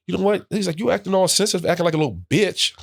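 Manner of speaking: high, mocking teammate voice; taunting teammate. Teammate calling rookie sensitive, high mocking. Teammate insulting him at dinner.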